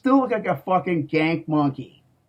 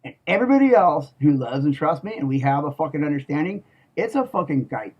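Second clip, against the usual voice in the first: ordinary talk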